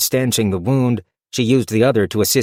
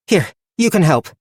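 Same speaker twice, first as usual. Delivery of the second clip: higher, brisk urging; Fern's voice; 8 semitones higher, 4 dB louder than usual. Acted. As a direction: chivvy him along. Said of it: "she said to Nigel".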